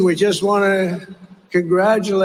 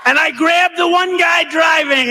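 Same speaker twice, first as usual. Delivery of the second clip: mock shout; 9 semitones higher, 4 dB louder than usual. Retelling absurd story, mocking.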